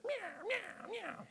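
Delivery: high, small mewing; tiny high-pitched meows; cat impression. Shown as a demonstration. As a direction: mew softly like a cat, way up in pitch. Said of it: "I speak cat. It sounds like this".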